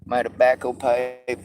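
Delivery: ordinary talk, nothing marked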